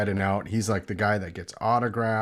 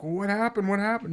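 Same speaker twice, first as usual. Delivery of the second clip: eager asking; 9 semitones higher, faster than usual. Retold or imitated. Eager question retold.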